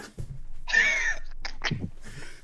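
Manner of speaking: very high squealing, wheezing laughter; helpless laughing fit. Real, not acted. Storyteller helpless at the memory of Voodoo, squealing laughter. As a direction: laugh until it turns to a squeal.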